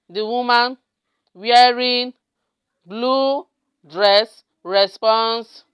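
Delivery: word-by-word typing voice; level, drawn-out words with long gaps; slow dictation. Real, not acted. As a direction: stretch each word as you type it.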